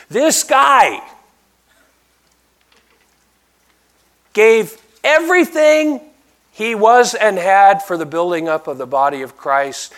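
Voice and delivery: loud, high pulpit cry; full-force preaching. Real, not acted. Preacher proclaiming his own epitaph, loud and fervent. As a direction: proclaim it at the top of your voice.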